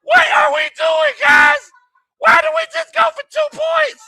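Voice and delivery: very high, loud screaming disbelief; furious shout. Real, not acted. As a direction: scream the question at the coaches. Outraged at the two-point try.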